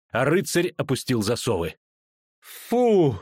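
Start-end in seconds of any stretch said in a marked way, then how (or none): 2.4-3.2 high loud "phew" of relief; выдох облегчения. A narrator's reading. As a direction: let out a big exhaled "phew". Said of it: "выдохнула девушка". (шумный выдох облегчения)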